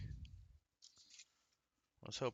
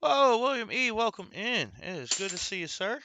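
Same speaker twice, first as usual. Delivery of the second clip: excited greeting; 9 semitones higher, 10 dB louder than usual. Real, not acted. Greeting a viewer in chat.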